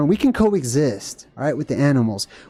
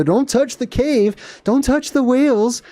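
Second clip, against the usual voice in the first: higher, drawn-out nagging mock; mocking rule-makers; 7 semitones higher, slower than usual. Mimicking authorities who forbid touching caves and whales, high mock. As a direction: parody the scolding authorities.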